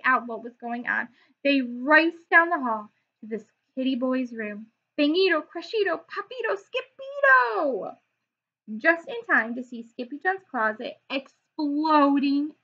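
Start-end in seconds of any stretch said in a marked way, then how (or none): 5.0-8.0 climbing excited cry. Acted. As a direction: call out the names in triumph. Boy cries out in play.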